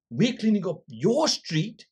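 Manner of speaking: reenacting an aggrieved complaint; retold indignant protest. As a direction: protest in disbelief, drawing it out slowly. Retells pleading with rude shopkeeper.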